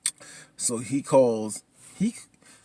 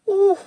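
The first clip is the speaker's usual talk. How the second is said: high, tearful "ooh"; mock sobbing moan; 8 dB louder than usual. Viewer reacting to the burial scene, mock tearful moan. Reacting to mama's sad line.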